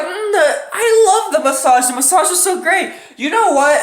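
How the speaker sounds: high, dreamy, relaxed "hey guys"; mock-blissful girlfriend voice; blissed-out girlfriend. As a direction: play the girlfriend floating out of a lovely massage. Girlfriend voiced praising massage.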